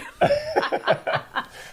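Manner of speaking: a high, breathy burst of laughter; high giggle. Real, not acted. Host laughing after admitting he doesn't know, giggling. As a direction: giggle at yourself.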